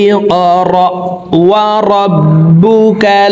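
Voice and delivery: high, slow melodic chant; recitation. Shown as a demonstration. Teacher reciting the second verse, high slow chant. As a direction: intone it slowly, held high.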